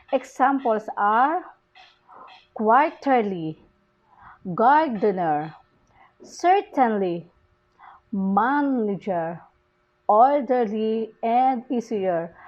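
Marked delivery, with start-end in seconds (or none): 2.5-12.3 slow, drawn-out QUAR-terly, GAR-dener, CER-tainly, MAN-ager, OR-derly, EA-sier; first-syllable stress. Shown as a demonstration. Teacher showing suffix-based stress, slowly stressing first syllables from quarterly to easier. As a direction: punch the first syllable of quarterly, gardener, certainly, manager, orderly, easier, slowly. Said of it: "stress is placed on the first syllables".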